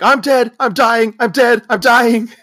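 high, loud hammed-up terror; mock panicked scream. Skier dragged behind speeding boat, screaming.

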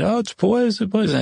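high-pitched acted panic; mock alarm. Clifford warning the others of poison, high mock alarm.

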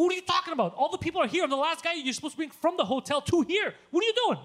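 high, loud retold rant; worked-up, high-pitched telling-off; exasperated yelling. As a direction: berate the driver in disbelief. Retold quarrel with shuttle driver.

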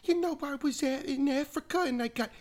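high, loud put-on voice telling a wild tale; Mister Weiss impression. Teen mimicking his teacher's Africa story, high and loud. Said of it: "He would tell these crazy stories like".

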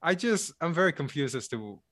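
usual speaking voice

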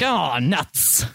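comic groan of defeat; thwarted Death. Acted. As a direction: play a hint of foiled Death. Death voiced as thwarted in skit.

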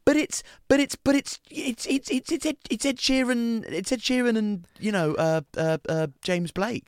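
high, stammering mock excitement; hammed-up flustered gabble, pitched high; overenthusiastic newcomer. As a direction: stumble over yourself, starstruck and overeager. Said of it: "it's way too enthusiastic".

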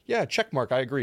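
high, loud, eager yes-man; mock eager agreement. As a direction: parrot it back eagerly. Mimicking people agreeing along.

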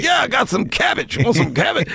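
high, teasing offer of cash; Redd Foxx voice. Retold or imitated. Redd Foxx showing off his wad of cash, high and teasing. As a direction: wave the wad and tease. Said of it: "It would probably be about twenty thousand".

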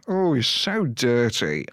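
slow drawn-out comic character voice; Alan Partridge. Retold or imitated. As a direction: stretch the oh like a smug presenter. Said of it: "I started doing a bit of an impression".

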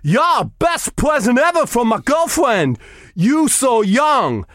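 put-on a loose German accent with a big finish; mock German accent. Thing Son boasting of his gift in a mock German accent. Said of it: "I think I'll do him as a German".

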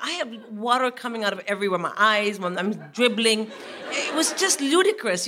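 ordinary delivery

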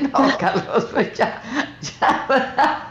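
laughing through the words; giggling while retelling. Retold or imitated. Retelling her answer to son.

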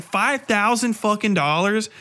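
normal talking voice

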